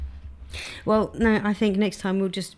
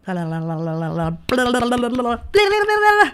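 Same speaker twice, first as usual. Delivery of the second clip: babbling nonsense; 3 semitones higher than usual. Real, not acted. Host gives up reading names, losing-it babble. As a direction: babble gibberish, rising to a manic squeal. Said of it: "She's finally lost it".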